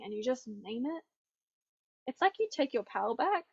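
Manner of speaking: ordinary talk, nothing marked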